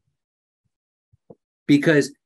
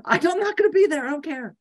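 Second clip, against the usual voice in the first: high, fast dismissive retort; 9 semitones higher, much faster than usual. Acted. High, fast brush-off to family who want to visit. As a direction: toss it off high and quick, not caring.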